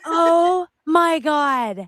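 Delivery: high, drawn-out laughing exclamation; cracking up. Real, not acted. Reacting to the screaming rant in the clip, cracking up. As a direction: laugh through it, loud and slow.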